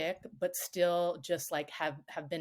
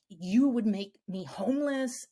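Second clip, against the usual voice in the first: mom's voice pushed up, mock dramatic outrage; mock raging mom; 4 semitones higher, 4 dB louder than usual. Mother raging at her daughter, mock-dramatic and raised. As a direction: play the dramatic mother hurling blame. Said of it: "she just starts raging".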